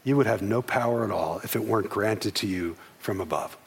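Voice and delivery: calm reply to an angry governor. Retold or imitated. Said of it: "very calmly says".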